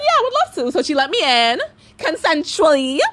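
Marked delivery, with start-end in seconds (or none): 0.0-0.7 high, sugary mock politeness; fake sweet. Accepting the mediator's offer, high and sugary fake-nice.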